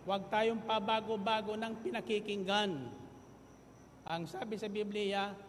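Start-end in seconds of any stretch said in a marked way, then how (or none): 0.0-2.7 loud, rising sermon delivery; full-force preaching. Acted. Pastor exhorting the congregation, loud preaching. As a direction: drive the point home to the congregation.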